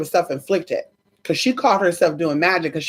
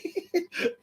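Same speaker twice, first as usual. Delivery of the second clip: high, quiet squeaky giggle; helpless laughter; 12 semitones higher, 11 dB quieter than usual. Real, not acted. Laughing at their own story, high squeaky giggle.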